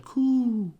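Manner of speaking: a single high, falling coo; pigeon coo. Pretend. Pigeon at the band shell, a high, falling coo. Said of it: "Pigeon goes again".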